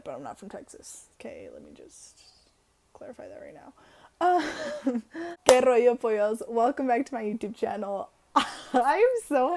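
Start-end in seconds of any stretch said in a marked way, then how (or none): 0.0-3.7 hushed whisper, as if sharing a secret; whispered aside. Pretend. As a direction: breathe it as a sneaky aside. Whispered repeat of the Texas disclaimer.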